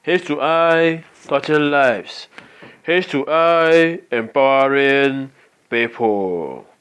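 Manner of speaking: slow, drawn-out slogan call with held words; slogan announcement. Acted. Presenter opening a training with the company motto, slow slogan announcement. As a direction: proclaim the motto, stretching each phrase. Recites organization slogan as opener.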